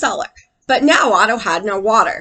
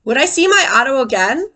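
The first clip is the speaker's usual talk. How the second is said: ordinary delivery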